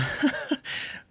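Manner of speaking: short breathy chuckle; amused laugh. Real, not acted. Reacting to the baffling champion quote, amused chuckle. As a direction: let out a quick, quiet laugh.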